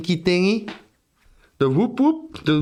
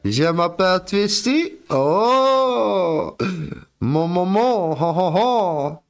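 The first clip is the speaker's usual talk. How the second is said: hammed-up French accent, higher; mock French; 4 semitones higher, slower than usual. Mock French accent for chat. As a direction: show off fake French to chat. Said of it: "My French is impeccable".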